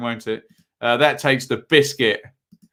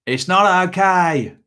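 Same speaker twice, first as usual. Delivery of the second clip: slow Kiwi "It's not okay", "not" pitched higher and leaned on; New Zealand accent; 6 semitones higher, slower than usual. Retold or imitated. Imitating old boss telling off badly behaved children, New Zealand accent. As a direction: scold the kids like a Kiwi boss, slowly. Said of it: "as my old boss used to say in a New Zealand accent".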